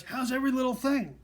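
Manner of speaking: high, loud imitation of shouting; shouted small talk. Coworker trying yet another opener, shouting. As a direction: holler it like a pushy coworker. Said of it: "She's still shouting".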